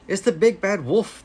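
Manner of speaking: high, alarmed cry; frightened cry. Acted. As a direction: cry out the danger. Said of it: "he cried".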